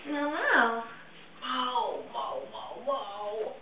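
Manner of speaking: meows fading into a whisper; soft imitated meows. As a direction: meow softly at the cat until it's only breath. Meows at her cat.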